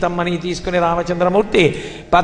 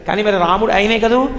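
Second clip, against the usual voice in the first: ordinary delivery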